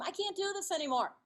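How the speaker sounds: high-pitched plea of defeat; retold wail at wit's end; exasperated giving up. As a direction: let the pitch leap as you give up. Retold outburst to her husband.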